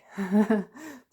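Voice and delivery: loud giggle; laugh. Real, not acted. Laughs at own icing remark.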